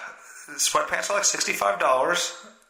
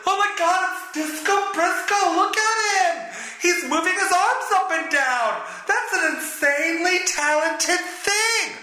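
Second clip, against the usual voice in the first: much higher, gushing fan voice, drawing out the praise; mocking gushing fan. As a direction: ham up an awestruck fan fawning over nothing. Mocking fans adoring Disco Briscoe.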